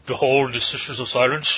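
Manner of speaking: grand announcing narrator; Orson Welles voice. Acted. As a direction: boom it out like an old trailer. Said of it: "talk like Orson Welles when we deploy".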